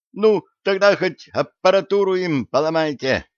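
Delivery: plaintive high-voiced outburst; falsetto. A narrator's reading. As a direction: pitch it up toward falsetto. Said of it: "фальцетом выдал плешивый товарищ".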